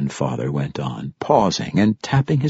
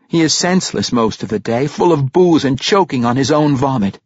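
higher, pressed accusation in character; grimly accusing Regis; 9 semitones higher, 5 dB louder than usual. Acted. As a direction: press the grim charge, louder. Narrator voices grim Regis accusing.

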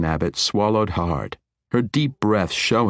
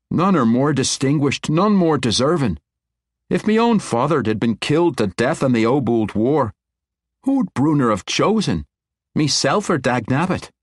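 higher, louder sincere praise; admiring dwarf king; 8 semitones higher, 4 dB louder than usual. Acted. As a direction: praise her with conviction. Conrad praises Dagnabbit.

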